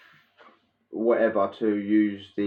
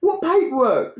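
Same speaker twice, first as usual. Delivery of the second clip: mocking disbelief; 13 semitones higher, 4 dB louder, slower than usual. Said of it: "we're laughing at him like".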